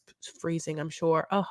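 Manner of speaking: ordinary delivery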